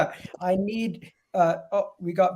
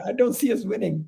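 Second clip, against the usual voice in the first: ordinary talk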